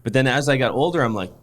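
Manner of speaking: normal talking voice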